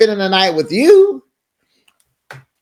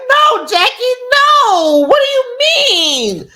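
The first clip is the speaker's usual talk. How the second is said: high pitched frantic plea; high panicked plea; pleading high; slower than usual. Retold or imitated. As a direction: beg her to stay, squeaking high. Urging Jackie not to leave.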